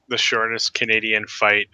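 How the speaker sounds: ordinary delivery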